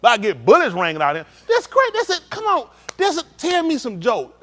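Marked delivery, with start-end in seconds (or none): 1.5-4.4 much higher, loud, jeering; mock crowd heckler. Audience member heckling the comedian, high jeering voice. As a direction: jeer at the comedian from your seat.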